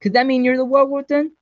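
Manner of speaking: loud, high accusing question; heated accusation. A narrator's reading. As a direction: fire the accusation across the room. Acted accusation in a quarrel.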